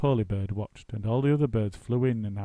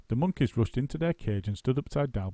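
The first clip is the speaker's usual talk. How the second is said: usual speaking voice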